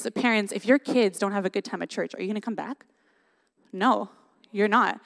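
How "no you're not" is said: ordinary talk, nothing marked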